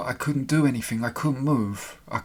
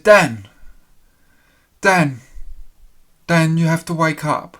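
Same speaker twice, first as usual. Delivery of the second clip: lightly voiced woman calling his name, higher; a hint of a woman gently calling Dan awake; female voice calling; 5 semitones higher, 8 dB louder than usual. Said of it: "A female voice".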